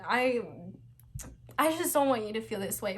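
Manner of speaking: crying. Real, not acted. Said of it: "I'm, like, crying".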